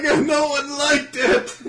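hammed-up wail, very high and loud; fake-cry it loudly, dragging the words out; mock crying. Imagined mangaka starts crying on the podcast, hammed-up wail. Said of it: "He just starts crying".

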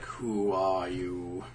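slow, low and eerie; mock-menacing slow voice; slow, low drawn-out question. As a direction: stretch out each word low. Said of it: "He says".

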